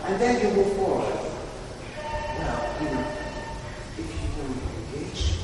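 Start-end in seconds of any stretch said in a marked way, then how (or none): 1.1-5.4 soft low warning; quiet menace. Shown as a demonstration. Drama teacher showing the genie's quiet threat. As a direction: lean in and warn them softly. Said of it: "you make a big step".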